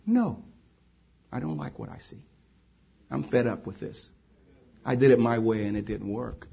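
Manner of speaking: low, hushed admission of defeat; weary confession. Acted. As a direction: confess it softly, as one worn out by life. Voices a convert's weary confession.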